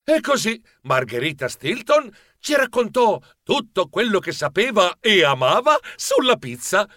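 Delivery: usual speaking voice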